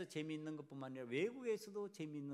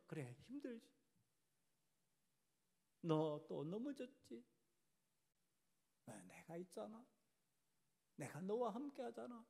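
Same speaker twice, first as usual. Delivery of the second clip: the Lord, consoling; 6 semitones higher than usual. Acted. The Lord reassuring one who fell again, tenderly consoling. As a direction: hush right down and reassure gently, leaving long pauses. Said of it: "우리 주님께서 그렇게 말씀하여 주신다는 거예요".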